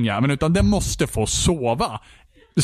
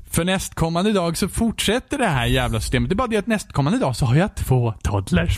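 ordinary delivery

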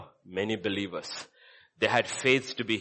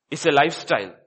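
normal talking voice

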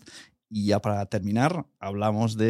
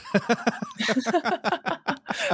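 high laugh; 12 semitones higher than usual. Real, not acted. Laughs at blind-date joke.

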